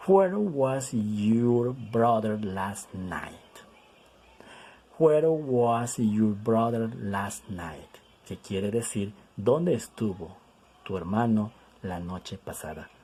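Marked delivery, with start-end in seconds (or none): 0.1-8.0 drawn-out, word by word; teacher reading an example very slowly; very slow model reading. Shown as a demonstration. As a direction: stretch each word for learners.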